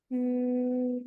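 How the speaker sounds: bee voice. Shown as a demonstration. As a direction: hum softly on the exhale like a bee. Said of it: "you make a buzzing sound like a bee".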